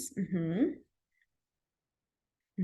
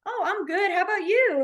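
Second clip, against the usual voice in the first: cheerful reply, pitched up; 8 semitones higher than usual. Retold or imitated. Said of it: "they might be like".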